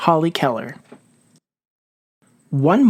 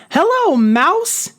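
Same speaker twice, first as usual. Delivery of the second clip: high, loud, slow call down into a hole; yelling down the hole; 13 semitones higher, 4 dB louder, slower than usual. Acted. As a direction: call down so it carries to the bottom. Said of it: "he yelled".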